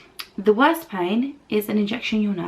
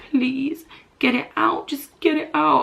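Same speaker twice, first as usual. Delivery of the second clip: retold pleading; 5 semitones higher than usual. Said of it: "I grabbed his arm and I was like".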